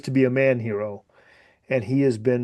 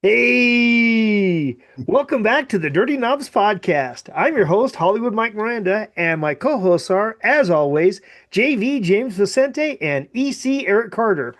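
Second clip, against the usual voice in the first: higher announcer voice for the intro; podcast host intro; 7 semitones higher than usual. Acted. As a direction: sell it like a radio host. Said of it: "you nailed that".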